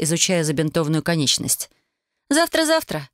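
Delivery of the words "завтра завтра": намного выше, насмешливое заверение; легко отмахнуться, посмеиваясь; со смешком. A narrator's reading. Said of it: "со смешком отозвалась Вайолет". (высоко, с легкой насмешкой)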